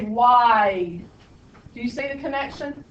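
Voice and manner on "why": "Why?" said as a crisp Yankee "why", a little louder; northern Yankee. Shown as a demonstration. Teacher contrasting her southern Y with a Yankee "Why?". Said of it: "But if I were from the north".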